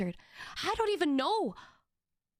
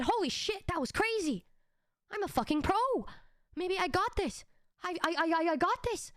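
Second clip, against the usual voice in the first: higher-pitched hyped self-quote; mock cocky excitement, pitched up; 4 semitones higher than usual. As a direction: act out pumping yourself up. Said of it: "I was getting hype".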